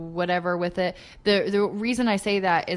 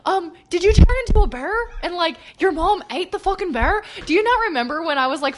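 higher, hammed-up bad Scottish accent; 10 semitones higher, 5 dB louder, faster than usual. Recalling reading Merida memes to a friend, bad Scottish accent. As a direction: ham up a terrible Scottish accent. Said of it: "the worst Scottish accent I could do".

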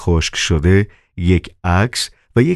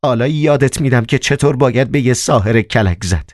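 high, howling threat with a far-off voice; howling fury; 7 semitones higher than usual. Acted. Margarita howls at Mogarych before clawing his face. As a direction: howl the threat at him. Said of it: "زوزه کشان گفت".

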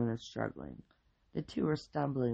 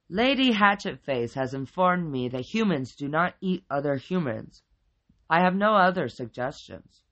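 louder, higher, declaiming creature voice; formal creature character; 4 semitones higher, 9 dB louder than usual. A narrator's reading. Creature reports what humans don't eat, formal and loud. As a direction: announce it like a stately creature.

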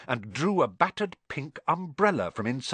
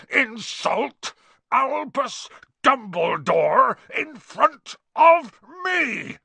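much louder and higher, very slow roar; thundering; 12 semitones higher, 5 dB louder, much slower than usual. Acted. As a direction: roar in defence of Dumbledore. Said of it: "he thundered".

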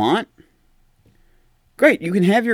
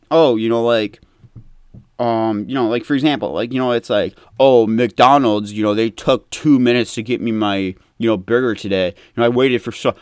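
mock complainer; 9 semitones lower than usual. Mocking a petty complainer.

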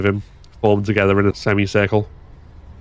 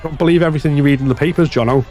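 fast, loud jeer; taunting heckle; 6 semitones higher, 4 dB louder, faster than usual. Acted. Third heckler taunting the foreman, fast jeer. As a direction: rattle it off to needle him. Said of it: "Third said".

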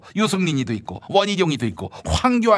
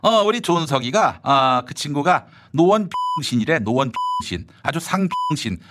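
normal talking voice

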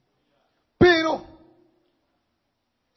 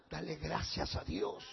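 ordinary talk

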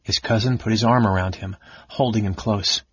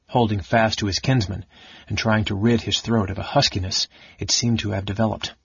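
ordinary delivery, nothing marked

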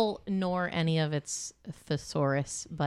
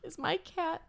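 worried late-night owner; 5 semitones higher, slower than usual. Retold or imitated. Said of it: "I probably would be like".

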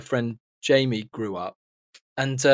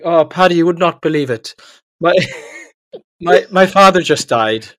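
higher, louder mock-Irish boy; bad Irish accent; 4 semitones higher, 8 dB louder than usual. Weeping boy telling the postman his news, bad Irish accent. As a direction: lament in a badly faked Irish accent. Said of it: "your Irish accent".

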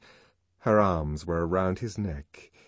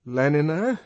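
apprehensive; 6 semitones higher, 6 dB louder than usual. Acted. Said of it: "he repeated apprehensively".

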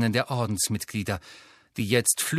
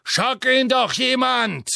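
much higher, louder slow yell; Death Eater shouting; 11 semitones higher, 5 dB louder, slower than usual. A narrator's reading. Death Eater struggling with Neville, strained yell. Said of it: "dass der Todesser rief".

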